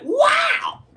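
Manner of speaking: amazed inner outburst; shocked exclamation. Retold or imitated. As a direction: yelp it out in amazement. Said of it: "that's what I'm like in my head".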